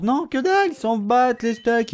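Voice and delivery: high then slow mocking jab; exasperated mockery; scornful outburst. Real, not acted. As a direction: scoff at the useless site. Mocking the website.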